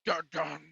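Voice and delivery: low, soft mock dun dun; dramatic sting hum. Host mocks the documentary's music with a soft dun dun. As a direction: hum a quiet ominous sting. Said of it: "it's like they play a, a musical pin drop".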